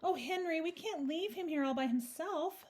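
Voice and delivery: high, concerned lady's voice; pleading wife. A narrator's reading. Mrs Brown begging Henry to help the bear, pleading.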